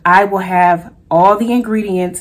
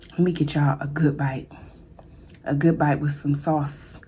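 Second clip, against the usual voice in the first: soft breathy aside to the viewers; whisper; 5 semitones lower, 8 dB quieter than usual. Real, not acted. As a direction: whisper it like a secret bite. Offering a bite to viewers.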